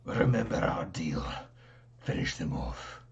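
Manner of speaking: low whispered villain threat. Acted. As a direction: hiss it like a fleeing villain. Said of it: "and he says".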